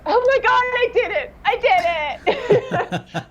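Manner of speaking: very high, thin excited squeal; mock-squealed triumph. Coach reliving relief after the actor's praise, high excited squeal. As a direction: squeal it way up high, stretching the last words into a laugh.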